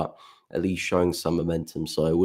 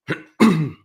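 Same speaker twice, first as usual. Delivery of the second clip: loud, forceful throat clear; 10 semitones higher, 6 dB louder than usual. Real, not acted. Croaky streamer mid-analysis, loud throat clear. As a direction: clear the throat hard, fed up with the voice. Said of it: "It's like my voice has, has it in for me today".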